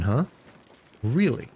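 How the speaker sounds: ordinary talk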